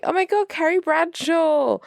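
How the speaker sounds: high-pitched fangirl recognition; starstruck fan. Retold or imitated. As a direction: gush as you spot your idol. Acting out the starstruck bookseller.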